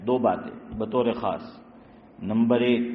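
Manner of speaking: usual speaking voice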